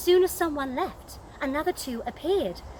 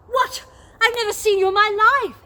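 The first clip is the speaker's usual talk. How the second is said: much higher, louder, fast disbelief; shocked exclamation; 8 semitones higher, 7 dB louder, faster than usual. Acted. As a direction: blurt it out, baffled. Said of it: "exclaimed Viola".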